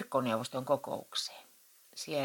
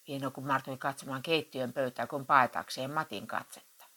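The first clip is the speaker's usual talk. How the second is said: plain reading voice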